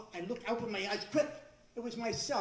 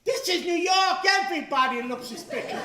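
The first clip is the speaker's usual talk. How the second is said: much higher, loud retort; exasperated shout; 8 semitones higher, 12 dB louder than usual. Retold or imitated. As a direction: fire it back at the conductor. Sarcastic retort to conductor.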